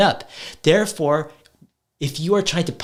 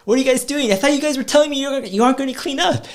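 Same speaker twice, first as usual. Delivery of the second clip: much higher, fast, feigned disbelief; mock surprise; 11 semitones higher, much faster than usual. Dad teasing kids who started cleaning, mock astonished. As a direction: play innocent shock at their cleaning.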